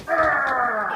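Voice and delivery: playful monster roar; growl. Shown as a demonstration. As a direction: roar like a giant to scare.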